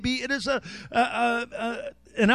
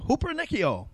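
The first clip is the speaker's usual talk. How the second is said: "hupernikio" run together as one quick word; said fast; 4 dB quieter than usual. Shown as a demonstration. Preacher showing off the word for conqueror, "hupernikio" said fast. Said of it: "Say that fast".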